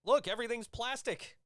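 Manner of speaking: high-pitched mock gasp of discovery; mock amazement. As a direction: exclaim it high, hamming up the wonder. Mocking how HD exposes film sets.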